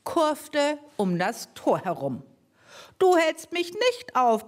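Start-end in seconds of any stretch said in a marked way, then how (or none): 3.0-4.4 the mower man refusing to be stopped; the red-faced driver roaring at Mortimer; angry bellow. Acted. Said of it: "brüllte er".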